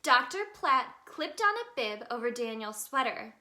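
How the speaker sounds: plain reading voice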